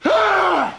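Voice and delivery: high, loud stricken "ah"; mock-terrified stage cry; panicked cry. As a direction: cry out as if the lines are gone. Acted stage fright before audience.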